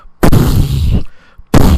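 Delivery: breathy unvoiced burst of air mimicking a fart; imitated fart burst. Recounting the never-ending bathroom noise, loud breathy imitated fart. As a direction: spit out a loud airy blast like a shotgun recoil. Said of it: "It was like".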